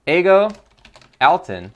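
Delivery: high, loud name call; announcer shout. Acted. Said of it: "that's what we call a Voice of Nick voice".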